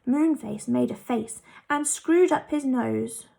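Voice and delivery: ordinary delivery, nothing marked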